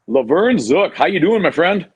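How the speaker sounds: loud, bright host welcome; excited greeting. Real, not acted. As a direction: boom out the guest's name. Host greeting guest on air.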